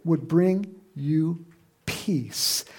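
plead, then let it fall; a hint of Jesus weeping over the city; Jesus lamenting. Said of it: "he said".

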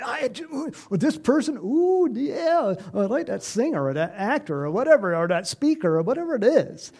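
gushing fan. Retold or imitated. Preacher imitates a fan gushing about a favorite star. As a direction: gush like a starstruck fan. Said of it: "you like".

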